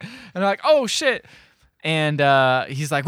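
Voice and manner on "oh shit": high, loud startled curse; mock alarm. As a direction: yelp it as if suddenly caught. Retelling frightened gang.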